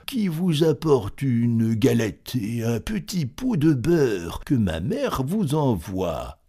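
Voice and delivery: slow put-on little girl from a wolf; wolf disguising voice. Wolf at the door, faking a child. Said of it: "en contrefaisant sa voix".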